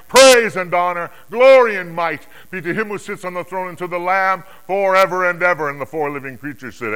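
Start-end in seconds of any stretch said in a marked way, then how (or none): 0.1-5.7 loud, high doxology; exultant worshipful proclamation; crying out praise. Acted. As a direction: ring out praise at full volume. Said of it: "all that is in them crying".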